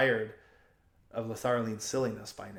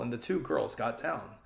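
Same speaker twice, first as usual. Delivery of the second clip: usual speaking voice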